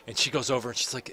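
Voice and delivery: ordinary talk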